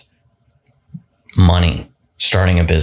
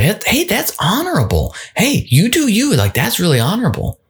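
much higher, sing-song mock praise; mocking inner voice; 13 semitones higher than usual. Inner voice teasing the dieter with high, mock approval. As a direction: pat them on the head, high and patronizing.

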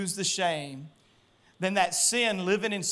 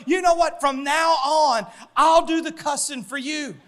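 loud, higher retold offer, slowing on "From now on"; hollering his buddy's promise; mock shout; 8 semitones higher, 6 dB louder than usual. Retelling the gym friend's offer.

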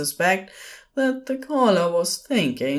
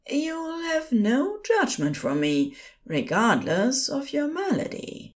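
soothing; 9 semitones higher than usual. A narrator's reading. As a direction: gently coax a distraught patient to speak. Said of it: "dropped into the soothing panic voice".

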